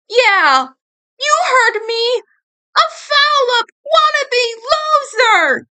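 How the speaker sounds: high, bitter shout at himself; self-loathing yell. Acted. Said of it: "he continued".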